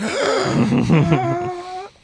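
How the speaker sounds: exaggerated drawn-out gasp, imitating friends choked by smoke; long, loud mock gasp. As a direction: gasp loudly as if starved of air. Mimicking everyone in the smoky car.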